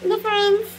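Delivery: ordinary delivery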